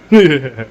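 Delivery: loud, hearty group laugh; retelling how the Native Americans laughed at his joke, a loud laugh; burst of laughter. Retold or imitated. Big roaring laugh. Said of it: "looked at me and went".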